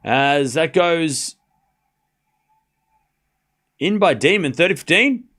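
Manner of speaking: slow, drawn-out tennis call; live play-by-play. Acted. Streamer calls the point for chat, like play-by-play.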